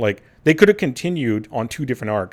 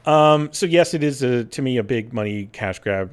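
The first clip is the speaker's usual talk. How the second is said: normal talking voice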